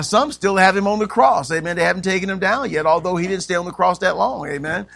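ordinary talk, nothing marked

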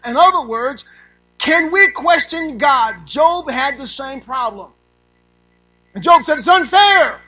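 preaching at full force. Acted. Preacher pressing the congregation, loud and high. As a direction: hammer the point home.

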